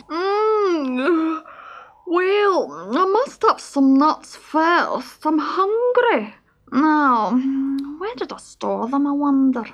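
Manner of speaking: high, slow, sleepy animal voice; drowsy squirrel. Acted. Just-woken squirrel wonders where the nuts are, drowsily. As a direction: ponder slowly, as a squirrel half awake.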